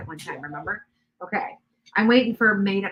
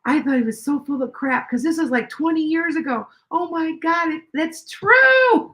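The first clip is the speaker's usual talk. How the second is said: friend's dawning surprise; 9 semitones higher, 5 dB louder than usual. Retold or imitated. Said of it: "And she looked up".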